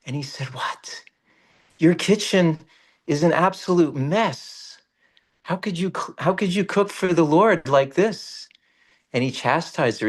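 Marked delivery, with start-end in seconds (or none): 0.5-1.0 breathless whispered What; whispered shock. Retold or imitated. Saint aghast at the messy kitchen, whispered. 1.8-8.5 higher-pitched indignant scolding. Retold or imitated. Saint scolding the mother over her kitchen, indignant.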